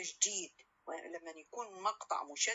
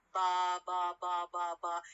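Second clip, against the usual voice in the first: every "ba" on the same level tone; monotone string of identical "ba" beats; even nonsense syllables; slower than usual. Shown as a demonstration. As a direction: chant "ba" evenly, with no syllable standing out. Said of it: "we have this example".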